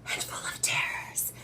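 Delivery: hushed, breathy-voiceless title reveal; spooky whisper. Acted. Host announcing the show's name, spooky whisper. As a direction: whisper it ominously.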